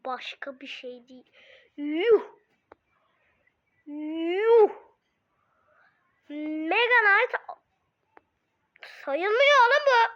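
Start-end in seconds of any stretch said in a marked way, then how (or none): 1.8-10.1 outraged yell. Real, not acted. Outraged by game result.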